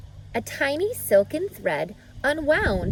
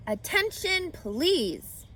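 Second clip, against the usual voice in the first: higher, firm announcement; loud, firm; 8 semitones higher, slower than usual. Acted. Wilbur addressing the barn, loud and firm. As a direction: call out firmly for attention. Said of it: "he said in a loud, firm voice".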